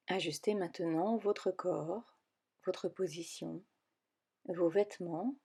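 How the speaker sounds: calm guided relaxation voice, with long pauses between phrases; guided meditation. Acted. Yoga teacher guiding listeners into yoga nidra, calm meditation voice.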